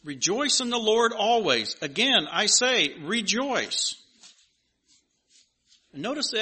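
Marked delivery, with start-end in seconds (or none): 0.0-4.0 louder, proclaiming reading of the verse; scripture reading. Acted. Pastor proclaiming Philippians to the congregation, louder. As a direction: ring it out to the congregation.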